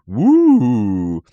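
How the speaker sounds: loud, high "woo"; excited whoop. Real, not acted. Streamer cheering the price chart, excited whoop.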